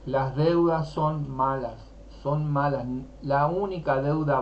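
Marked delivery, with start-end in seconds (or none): 0.1-2.9 "Las deudas son malas" said slowly, each word weighed; slow firm emphasis. Shown as a demonstration. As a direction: spell out "malas" slowly and firmly. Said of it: "y decir". (lento y enfático, "malas" remarcado)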